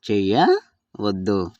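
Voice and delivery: slow చేయవద్దు, broken after a rising చేయ; part by part. Shown as a demonstration. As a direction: split చేయవద్దు in two for learners. Teacher repeats word in parts. (నెమ్మదిగా, విడదీసి)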